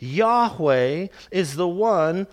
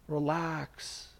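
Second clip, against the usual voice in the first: Relax said very softly, drawn out and falling; soft soothing relax; 3 semitones lower, 10 dB quieter than usual. Shown as a demonstration. Preacher calming his congregation, softly soothing. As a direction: breathe out the word Relax gently. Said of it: "take a deep breath".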